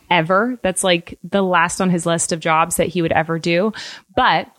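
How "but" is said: ordinary delivery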